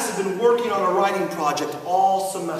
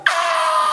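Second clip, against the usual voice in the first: put-on kid's shriek; 3 dB louder than usual. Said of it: "he looked at me and he went".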